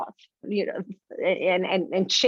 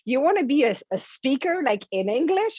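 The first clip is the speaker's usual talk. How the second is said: higher-pitched incredulous self-doubt voice; mocking inner critic; 6 semitones higher than usual. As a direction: scoff in disbelief at the very idea. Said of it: "the voice was telling me".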